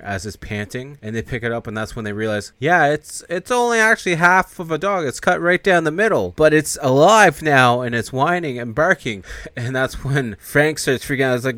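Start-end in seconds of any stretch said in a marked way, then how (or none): 2.6-9.2 excited. Retold or imitated. Retelling the half-dog discovery.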